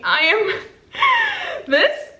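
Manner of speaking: high, excited squeak of delight; squealing with joy as she opens it. Real, not acted. Opening a gift from Gavin, squealing with joy.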